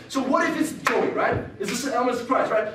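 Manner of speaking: usual speaking voice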